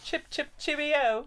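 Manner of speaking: mock British phrase.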